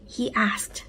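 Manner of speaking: ordinary delivery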